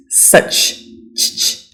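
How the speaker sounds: such, whispered CH drawn out; CH sound. Shown as a demonstration. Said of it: "not suck as".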